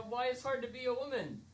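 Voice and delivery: ordinary delivery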